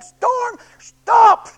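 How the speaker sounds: loud, slow command. Acted. Jesus standing up in the boat, loud slow command to the storm. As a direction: order the storm to stop with full authority.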